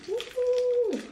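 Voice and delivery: high, loud woo-hoo; excited cheer. Real, not acted. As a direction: cheer yourself on. Psyching up before the test.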